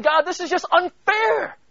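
loud, high, slow cry of protest at God; yelling at God. Pretend. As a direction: shout the unfairness up to heaven. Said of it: "yelling at God and saying".

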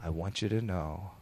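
very soft, earnest declaration of love; husband quietly and solemnly telling his wife he loves her; serious and sincere. Retold or imitated. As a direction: look her in the eye and mean it. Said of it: "Wow, so serious".

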